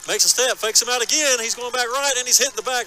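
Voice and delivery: rising, loud play-by-play call; excited. Real, not acted. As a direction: build the call as he breaks free. Big run, live play-by-play.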